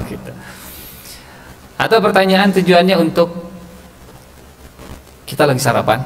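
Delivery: usual speaking voice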